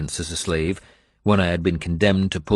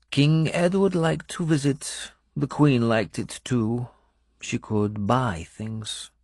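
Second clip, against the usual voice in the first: a hint of a higher foreign woman's voice, slowing; lightly voiced foreign woman; 6 semitones higher, slower than usual. A narrator's reading. Benedetta telling the narrator about the king, lightly accented.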